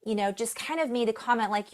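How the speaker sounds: normal talking voice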